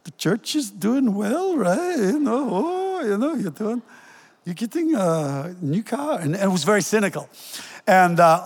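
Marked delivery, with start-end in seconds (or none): 0.0-6.2 much higher, put-on Scottish neighbour voice, slow in places; preacher imitating the Scottish neighbour mocking his new car in a Scottish accent; thick Scottish accent. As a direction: mimic the Scottish neighbour's sly, cynical dig in a broad Scottish accent. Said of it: "in a very thick Scottish accent".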